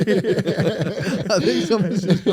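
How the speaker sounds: slow, giggling words; stretched words broken by laughter; laughing through words. Real, not acted. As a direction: try to talk while cracking up. Laughing mid-sentence.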